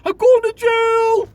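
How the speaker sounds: high, slow cry of panic as the trucker thinks he is busted; panicked. Retold or imitated. Trucker to himself at the inspection, panicked high slow wail. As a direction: wail it like you're already caught, drawing the words out. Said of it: "I'm like".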